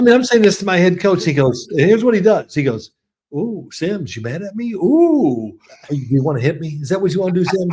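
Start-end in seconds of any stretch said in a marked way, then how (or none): 3.3-7.7 high, teasing "ooh" in mock fear; imitated mocking taunt. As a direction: taunt him as the coach would. Coach mockingly taunts angry player.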